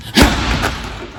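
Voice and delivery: slow relaxed breath out; long exhale. Shown as a demonstration. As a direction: breathe out to calm. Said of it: "look, I'm breathing".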